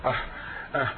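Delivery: voiceless, strangled attempt to speak. Struck speechless by the stranger's words, voiceless stammer. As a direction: try to speak but the voice won't come. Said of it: "But I had no voice".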